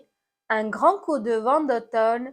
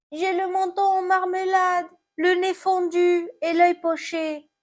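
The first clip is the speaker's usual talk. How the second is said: high, plaintive apple voice; hurt apple; 8 semitones higher than usual. Acted. Fallen apple answers about its injuries, high lament. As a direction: lament your bruises in a raised voice.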